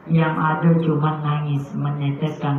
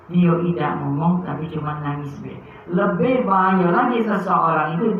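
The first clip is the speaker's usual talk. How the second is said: ordinary talk, nothing marked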